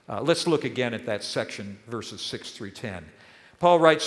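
ordinary talk, nothing marked

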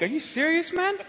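high disbelieving are you serious; incredulous. Retold or imitated. Telling the host he must be joking, incredulous. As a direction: jump up in pitch, stunned.

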